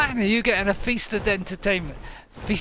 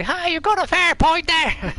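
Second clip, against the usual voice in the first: loud, high, excited greeting; shouted high-pitched streamer voice; Jacksepticeye impression; 9 semitones higher, 5 dB louder than usual. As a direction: bellow it like an over-the-top YouTuber. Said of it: "As Jacksepticeye would say".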